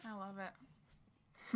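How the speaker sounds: slow, soft I love it; quiet drawn out. Real, not acted. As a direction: breathe it out slowly.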